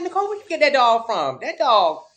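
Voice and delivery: exaggerated disbelief at the dog; mock incredulous voice. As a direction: act shocked at her dog. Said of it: "I was like".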